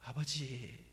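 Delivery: quiet sigh calling 아버지; sighed father. Retold or imitated. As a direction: let the word fall out with a sigh. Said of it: "깊은 한숨을 내쉬면서".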